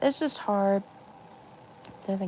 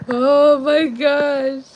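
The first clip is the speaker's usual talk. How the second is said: slow despairing groan; 3 semitones higher, 7 dB louder, much slower than usual. Real, not acted. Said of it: "mental breakdown".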